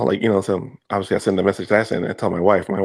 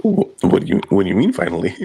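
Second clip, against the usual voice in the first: mock disbelief; 3 semitones lower, 4 dB louder than usual. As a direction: stretch out the confusion on finally. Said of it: "And I went".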